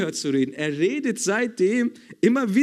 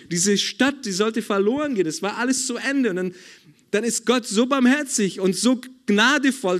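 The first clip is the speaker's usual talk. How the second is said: ordinary talk, nothing marked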